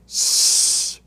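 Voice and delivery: S as a long voiceless hiss, "sss"; hard S. Shown as a demonstration. Teacher showing the hard sound of double S, hissed "S". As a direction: sound out S itself, not the letter name. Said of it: "pronounced in its hard sound".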